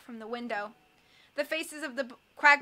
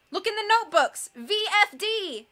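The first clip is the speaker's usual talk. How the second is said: desperate shouted message; scream; 8 semitones higher, 6 dB louder than usual. A narrator's reading. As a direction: scream the vital clue. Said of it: "Duncan screamed".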